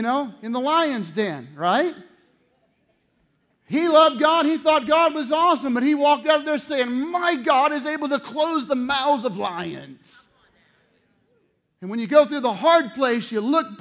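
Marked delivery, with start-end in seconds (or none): 3.7-10.3 loud, high preaching build. Acted. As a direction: preach it with fire. Preaching at full force.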